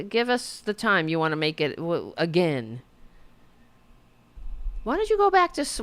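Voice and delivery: normal talking voice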